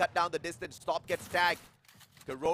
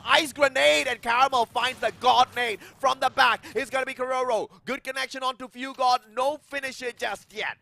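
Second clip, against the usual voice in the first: rising hype, louder and higher; excited play-by-play; 6 semitones higher, 7 dB louder than usual. Real, not acted. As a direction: call the fight with building energy. Fight heats up, caster speeds up.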